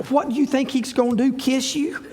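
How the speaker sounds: quick, soft laughing tease; teasing with a laugh. Retold or imitated. Husband teasing scared wife, laughing softly. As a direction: poke fun at her while laughing.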